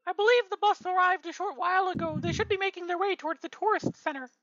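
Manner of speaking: high squeaky elf voice; squeaky little elf helper. Acted.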